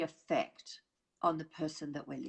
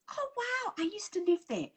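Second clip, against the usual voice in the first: eager interruption; 12 semitones higher than usual. Retold or imitated. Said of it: "I'd come out with".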